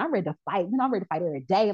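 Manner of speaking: normal talking voice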